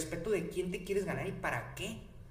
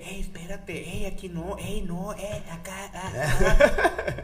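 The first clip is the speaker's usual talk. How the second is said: imitación burlona; 3 semitones higher, 8 dB louder, slower than usual. Retold or imitated. Imita a quien lo frena.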